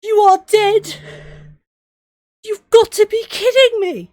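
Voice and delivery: high, loud disbelieving yell; can't accept it, shouting at the screen; outraged shout. Real, not acted. Player shouting at the death screen in outrage.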